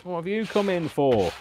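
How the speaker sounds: high, loud pet-talk voice. Real, not acted. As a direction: coo a question at the dog. Talking to pet that walked in.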